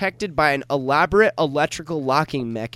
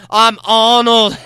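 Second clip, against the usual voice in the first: slow, loud and higher Arnold voice; Arnold impression; 5 semitones higher, 9 dB louder, slower than usual. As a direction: boom it out slowly as Arnold. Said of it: "my Arnold impression".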